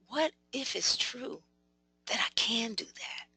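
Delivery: soft breathy whisper of a secret hope; hushed, hopeful inner voice. Acted. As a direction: whisper it like a private thought. Voicing a hopeful inner thought.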